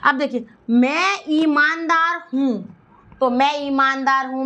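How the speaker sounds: usual speaking voice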